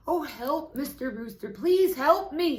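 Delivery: high, loud panicked goose cry; silly goose shrieking help. A narrator's reading. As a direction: squawk for help like a frightened goose. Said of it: "she cried".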